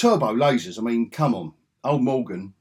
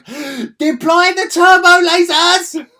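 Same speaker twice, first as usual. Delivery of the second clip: high, slow, hammed-up yelling order; mock villain shout; 13 dB louder, slower than usual. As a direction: bellow the command like a cartoon commander. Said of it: "She goes".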